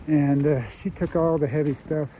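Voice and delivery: usual speaking voice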